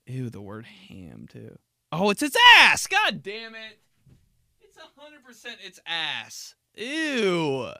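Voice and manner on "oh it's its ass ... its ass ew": much louder, higher, disgusted yell; disgusted outburst. Real, not acted. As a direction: blurt it out in disgust. Disgusted outburst about ham.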